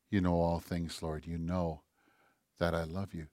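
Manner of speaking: Peter's broken admission, almost to himself; quiet humbled answer. Acted. Peter answering Jesus the third time, hushed and ashamed.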